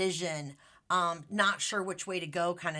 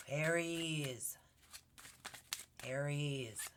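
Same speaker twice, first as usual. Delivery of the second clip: quiet; 4 semitones lower, 9 dB quieter, much slower than usual. Real, not acted. Pulling cards in a quiet ritual.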